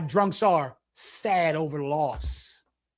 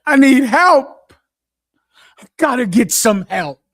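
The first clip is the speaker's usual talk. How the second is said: put-on a drunk begging for help, voice rising to a shout; desperate alcoholic plea; 3 semitones higher, 10 dB louder than usual. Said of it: "That's alcoholic talk".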